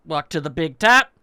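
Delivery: ordinary talk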